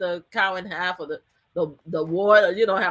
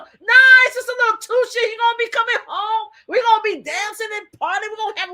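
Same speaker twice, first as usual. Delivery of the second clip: mocking supporter; 11 semitones higher, 5 dB louder than usual. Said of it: "we have people saying".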